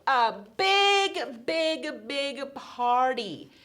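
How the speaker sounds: put-on celebrating the happy ending for the kids; excited build. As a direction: make the party sound huge.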